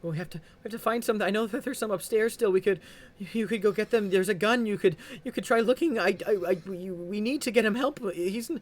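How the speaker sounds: high, loud, fast frantic begging; desperate pleading. Acted. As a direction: beg them to find supplies. Wife begs for medical help.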